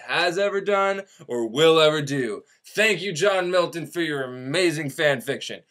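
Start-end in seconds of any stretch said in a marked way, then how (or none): none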